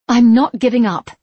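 loud, high declaration; announcing. A narrator's reading. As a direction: proclaim it defiantly. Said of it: "Anne announced".

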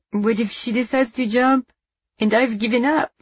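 lightly voiced grieving boy; high, small, lost boy's worry; mournful. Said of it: "he mourned".

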